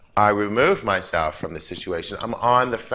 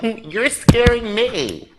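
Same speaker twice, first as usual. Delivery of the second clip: high, slow, laughing "you're scaring me"; hammed-up fright dissolving into laughter; laughing mock fear; 11 semitones higher, 5 dB louder, slower than usual. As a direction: giggle through a pretend scared line.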